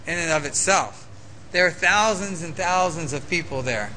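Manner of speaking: usual speaking voice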